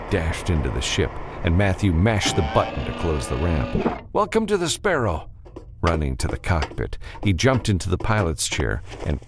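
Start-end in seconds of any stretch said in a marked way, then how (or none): 4.1-5.3 high-pitched called-out welcome; raised-pitch exclamation; hurried welcome. A narrator's reading. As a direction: call it out high, in a rush of action. Hero greets passenger while fleeing.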